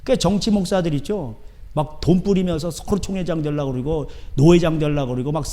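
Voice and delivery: normal talking voice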